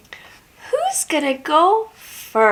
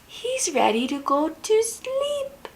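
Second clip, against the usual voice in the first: higher, soft sleepy voice; hushed; 6 semitones higher, 7 dB quieter than usual. Acted. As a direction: lull it gently. Bedtime hush.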